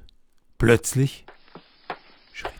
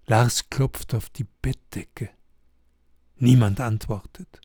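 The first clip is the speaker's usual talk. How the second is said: plain reading voice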